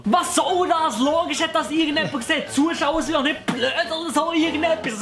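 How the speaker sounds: mock outrage. Parodie, empört gespielt.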